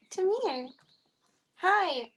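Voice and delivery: high pet-talk call; calling the cat. Real, not acted. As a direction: squeak it up high to lure the kitty.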